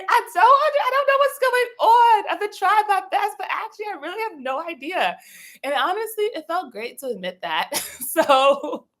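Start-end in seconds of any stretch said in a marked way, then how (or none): 0.1-5.2 high, pleading confession of cluelessness; a high, vulnerable plea. Retold or imitated. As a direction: let it crack open, helplessly overwhelmed. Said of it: "it got very vulnerable".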